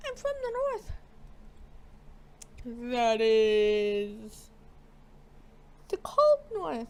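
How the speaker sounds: hammed-up voice for a northern girl; character voice. Voicing a game character's line.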